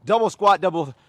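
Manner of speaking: loud workout instructions; yelling. Real, not acted. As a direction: bellow it to the whole class. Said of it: "yell so loud".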